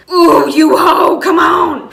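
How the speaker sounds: loud, slow, crying-out insult at the waiter; hammed-up outburst she never really said; mock furious yell. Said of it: "I didn't say that".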